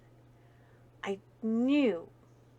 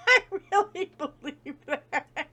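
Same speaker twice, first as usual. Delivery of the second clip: laughing; 6 semitones higher, 4 dB louder than usual. Real, not acted. Laughing at her own joke.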